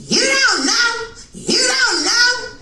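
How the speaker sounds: high, slow shouted you don't know; mama yelling. Retold or imitated. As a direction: scream it slowly like an angry mom. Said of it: "my mama going".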